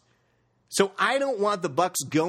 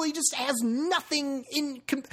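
normal talking voice

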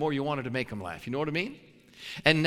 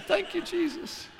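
relieved thanks. Retold or imitated.

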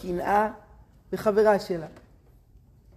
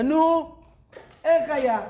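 higher and louder, eager to hear the news; eager question; 8 semitones higher, 7 dB louder, slower than usual. Retold or imitated. Friend waiting outside the interview asks eagerly. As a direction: burst out asking how it went.

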